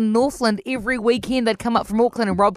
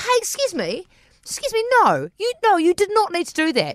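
high-pitched, affronted objection; retold indignant protest; 8 semitones higher than usual. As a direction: object firmly, pitched well up. Protesting after friend is knocked down.

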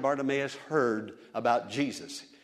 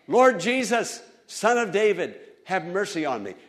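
loud, higher plea to Jesus; crying out; 7 semitones higher, 7 dB louder than usual. Retold or imitated. Blind beggar begs Jesus for mercy, crying out loud. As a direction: call out for mercy with all he has. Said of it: "blind Bartimaeus cried out".

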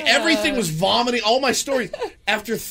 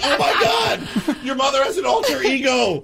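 retold astonishment; 6 semitones higher than usual. Co-hosts learning of the alter ego, high amazed outburst. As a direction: exclaim it half laughing, floored. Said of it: "I would fall down, like".

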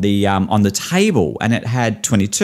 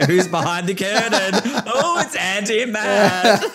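slow, higher, giggling mock guest voices; laughing through quotes; 8 semitones higher, slower than usual. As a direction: play the nosy guests while cracking up. Imagined guests at curtain reveal.

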